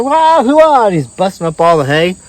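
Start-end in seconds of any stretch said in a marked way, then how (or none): 0.0-0.9 high, loud hooting yell; loud ghost whoop. Retold or imitated. Ghost bellowing his cheer while busting hay. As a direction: holler it like a wild fan. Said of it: "He's like".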